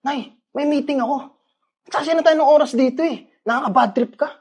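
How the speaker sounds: angry shouting. Acted. Son keeps yelling at mother.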